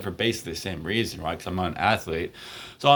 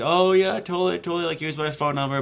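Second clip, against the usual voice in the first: imitating her eager yes, pitched up; higher, breezy mock enthusiasm; eager agreement; 6 semitones higher than usual. Classmate saying yes to being asked out, eagerly. She happily agrees to date.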